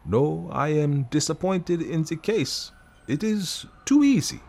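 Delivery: lightly voiced fussy Belgian detective; Poirot voice.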